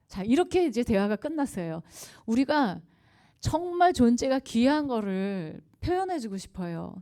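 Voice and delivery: ordinary talk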